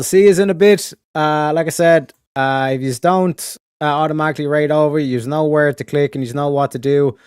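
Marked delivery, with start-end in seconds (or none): none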